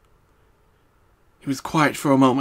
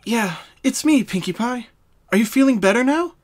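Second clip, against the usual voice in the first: raised, gently concerned mare; Twilight's voice; 10 semitones higher, 5 dB louder than usual. Acted. Twilight checking on her dazed friend, concerned.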